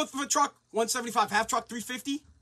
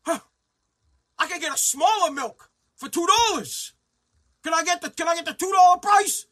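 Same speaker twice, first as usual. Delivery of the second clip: mock haggler at the till; 4 semitones higher, 5 dB louder than usual.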